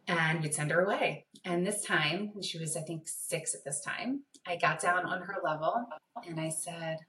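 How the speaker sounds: ordinary talk, nothing marked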